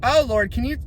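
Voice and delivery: ordinary talk, nothing marked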